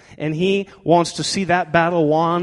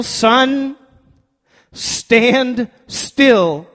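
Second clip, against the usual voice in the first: raised, solemn reading of the verse; scripture reading; 3 semitones higher, 5 dB louder than usual. Acted. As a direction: proclaim the Bible passage slowly to the church. Reads scripture aloud.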